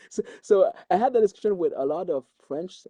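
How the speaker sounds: normal talking voice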